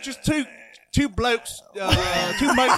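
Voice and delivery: high, hammed-up British accent. Friend trying out a British accent on a pal, hammed-up. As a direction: play a stock English bloke. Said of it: "if you go to London".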